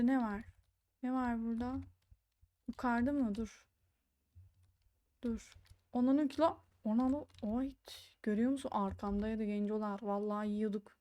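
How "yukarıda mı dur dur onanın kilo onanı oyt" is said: usual speaking voice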